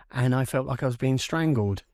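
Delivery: ordinary talk, nothing marked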